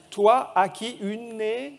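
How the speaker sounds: slow, stretched "Toi, à qui une est", rising at the end; angel's little accent. Acted. Preacher building a rebus for children, angel's little accent. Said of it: "il a un petit accent, l'ange, toujours".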